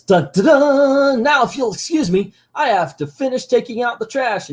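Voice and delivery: high, triumphant superhero send-up; Captain Planet. Retold or imitated. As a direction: sing the fanfare, then proclaim like a hero. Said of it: "And Captain Planet says".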